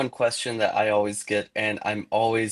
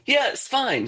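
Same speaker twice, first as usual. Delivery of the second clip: much higher breezy voice, brushing it off; imitating the chief's daughter; slower than usual. Daughter waving away the chief's objection, high breezy voice. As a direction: toss it off lightly, way up high.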